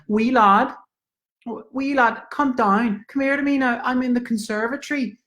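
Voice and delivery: high, lilting Irish landlady calling; a high Irish old lady calling up the stairs. Acted. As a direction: call out sweetly like an old Dublin lady. Landlady calls from conservatory.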